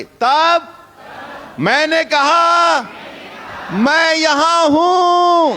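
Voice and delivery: high, slow, drawn-out declaration; loud proclamation. Shown as a demonstration. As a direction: proclaim it for all to repeat. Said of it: "बड़े ऊंची आवाज में कहें".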